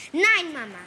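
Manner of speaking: loud, high cry of defiance; angry shout. Acted. Luisa yells back at her mother, furious. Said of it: "rief Luisa zornig".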